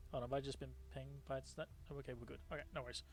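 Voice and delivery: very soft, low, quick aside; private aside. Real, not acted. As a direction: drop right down as if checking to yourself. Aside while checking a ping.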